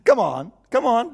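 ordinary talk

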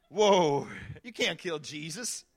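ordinary delivery

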